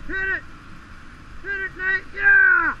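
cheering on. Real, not acted. Cheering Nate through.

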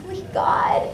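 high, breathy half-laughing cry to heaven; exasperated plea. Retold or imitated. To God about the roommate picking her feet, laughing exasperation. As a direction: throw it up to God in laughing disbelief.

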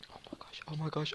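quick hushed disbelief; very soft, hurried amazement; awed whisper. Real, not acted. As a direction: whisper it fast under your breath. Awed on seeing the spawner.

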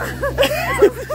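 laughing. Real, not acted. Laughing while filming in snow.

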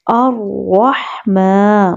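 usual speaking voice

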